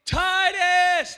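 high, loud shouted name; yelling a name. Retold or imitated. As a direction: shout it like a lost child's father.

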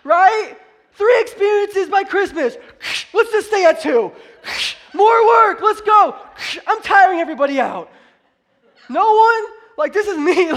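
high, very loud exclamations; full-force preaching shout. Acted. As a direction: shout it out to fire up the room.

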